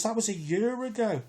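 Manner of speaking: high-pitched indignant objection; indignant disbelief. Retold or imitated. To the police at the door, high indignant disbelief. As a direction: up in pitch, can't believe it.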